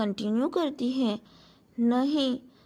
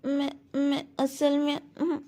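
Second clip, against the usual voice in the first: halting, unsure little voice; hesitant. A narrator's reading. Girl hesitantly answers the duke, halting and unsure. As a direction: stumble over the words nervously. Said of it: "हिचकिचाते हुए उनसे बोलने लगती है".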